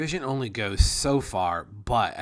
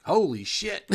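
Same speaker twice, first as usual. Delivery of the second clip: high, loud astonished exclamation; loud amazement; 12 semitones higher, 6 dB louder than usual. Real, not acted. Game master stunned by the huge map, amazed outburst.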